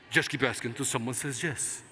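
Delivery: put-on low, soft Spanish-accented advice; Ricardo Montalban voice. Mentor revealing the secret to success in a low Spanish accent. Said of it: "what do I hear in my head".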